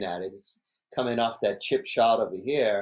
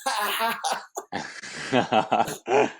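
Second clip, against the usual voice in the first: mocking laugh; much slower than usual. Imitating Brock laughing at him, taunting mock laugh. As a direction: gloat with a fake laugh. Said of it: "looking at me and just going".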